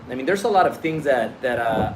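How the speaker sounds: usual speaking voice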